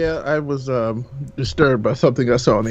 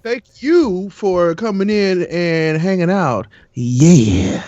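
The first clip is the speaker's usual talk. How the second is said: slow, drawn-out late-night jazz host; smooth jazz radio voice; 3 semitones higher, 3 dB louder, much slower than usual. Acted. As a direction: croon it slowly like a jazz DJ. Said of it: "jazz, smooth jazz radio voice on".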